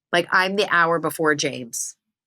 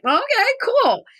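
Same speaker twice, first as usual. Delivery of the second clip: fake casual; 3 dB louder than usual. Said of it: "And I'm like".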